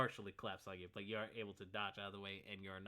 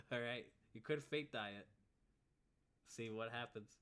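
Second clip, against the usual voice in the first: ordinary talk, nothing marked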